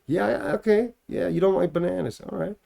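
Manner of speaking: lightly put-on American accent, casual. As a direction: answer her in a breezy American accent. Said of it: "talking back to her in American".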